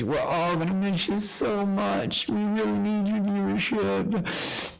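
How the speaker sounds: sarcastic. Acted. Streamer mockingly bids banned viewer farewell, sarcastic. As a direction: fake sweet goodbye dripping with sarcasm.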